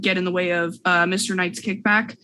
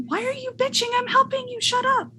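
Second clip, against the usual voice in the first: much higher, cartoonish nagging voice; high squeaky scolding voice. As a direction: scold them in a squeaky put-on voice.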